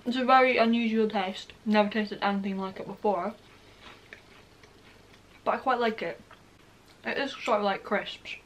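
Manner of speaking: talking between chews; slightly muffled by food; mouth full, chewing. Real, not acted. Seen 3: Bites cracker at -2s; jaw and lips keep working while she talks.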